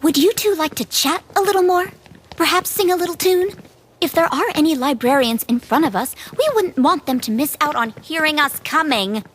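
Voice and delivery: put-on very high, cutting sarcastic girl's voice; mock-sweet offer with an irritated edge; sarcastic scolding. Bastille scolds two chatting companions ahead of danger, sarcastic. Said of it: "thing about sarcasm".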